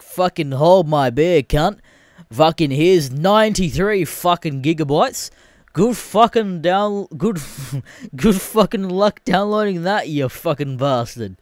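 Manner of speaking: slow, swaggering taunt; Shadow of War. Acted. Game outdoing Titanfall's huge download, cocky taunting. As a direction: draw it out cockily, a laugh breaking in. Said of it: "Shadow of the War over here is just like".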